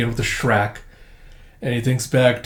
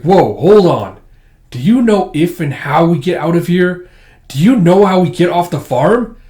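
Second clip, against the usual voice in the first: loud, raised-pitch pressing questions; acted insistent outburst; urgent demand; 5 semitones higher, 7 dB louder than usual. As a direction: stop them and demand an answer. Lucy demands answers from the Colonel.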